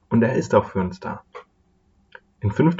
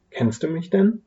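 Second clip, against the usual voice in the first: higher-pitched wary question; mistrustful; 8 semitones higher than usual. A narrator's reading. Sadness asks the old woman if she knows her, mildly mistrustful. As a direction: ask with a touch of suspicion. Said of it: "fragte die Traurigkeit misstrauisch".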